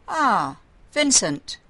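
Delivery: called loudly, pitch up high; calling out. A narrator's reading. Jacob calls out to Vincent passing his chamber, loud call. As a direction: hail him from the chamber.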